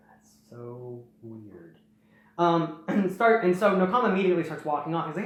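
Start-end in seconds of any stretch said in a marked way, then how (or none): 0.1-1.8 very soft, slow, low musing; puzzled aside. Retold or imitated. Vakama puzzled as the Toa leaves, soft musing aside. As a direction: murmur it slowly to yourself.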